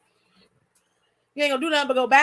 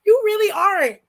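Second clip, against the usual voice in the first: emphatic; 6 semitones higher, 5 dB louder than usual. Real, not acted. Emphatic to viewer.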